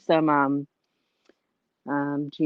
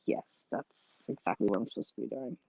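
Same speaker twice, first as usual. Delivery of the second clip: soft, lower self-talk; quiet muttering; 5 semitones lower, 11 dB quieter than usual. Retold or imitated. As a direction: mumble it to yourself. Retold realization about alarm.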